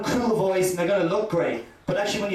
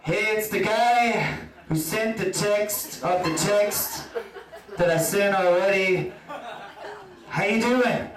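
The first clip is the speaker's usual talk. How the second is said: eager texter; slower than usual. Pretend. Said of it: "drop her another text".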